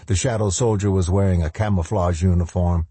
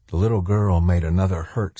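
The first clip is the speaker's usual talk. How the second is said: plain reading voice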